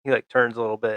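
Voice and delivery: normal talking voice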